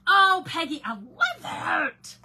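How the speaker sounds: loud, high delighted greeting; excited shout. Real, not acted. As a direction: call out in delight.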